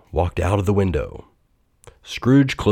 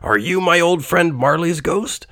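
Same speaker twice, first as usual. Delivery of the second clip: put-on higher, wary old man's voice; frightened Scrooge; 9 semitones higher than usual. A narrator's reading. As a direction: ask fearfully. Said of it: "questioned Scrooge".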